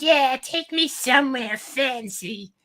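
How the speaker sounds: put-on high, drawn-out falsetto girl; slow, squeaky-high mock date voice; date's girly voice.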